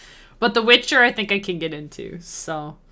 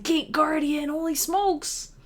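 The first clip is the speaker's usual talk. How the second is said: excited whisper; 9 semitones higher, slower than usual. Retold or imitated. Retold childhood excitement.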